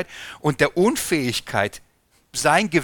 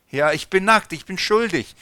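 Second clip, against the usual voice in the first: higher, louder confession of guilt; guilty Adam; 6 semitones higher, 5 dB louder than usual. Acted. Adam confesses his guilt to God, higher and louder. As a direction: blurt it out like a caught man.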